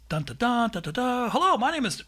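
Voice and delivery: high, upbeat podcast opener; demonstrating intro music. Shown as a demonstration. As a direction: count it in, sing the beats, launch into hello. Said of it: "the intro music".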